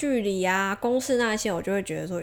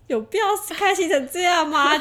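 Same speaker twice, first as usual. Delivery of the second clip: incredulous sister; 10 semitones higher, 5 dB louder than usual. Retold or imitated. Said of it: "我姐就觉得".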